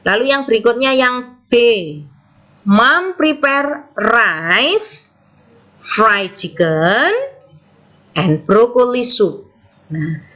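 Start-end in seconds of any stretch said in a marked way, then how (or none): 2.6-9.5 very slow list, lifting and pausing after "rice" and "chicken"; pause at commas. Shown as a demonstration. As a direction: stretch each item, stop briefly at each comma before "and broccoli soup". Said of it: "intonasinya kita berhenti sebentar". (intonasi koma, pelan, berhenti sebentar setelah "rice" dan "chicken")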